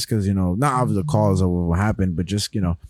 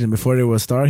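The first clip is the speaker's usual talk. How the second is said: ordinary talk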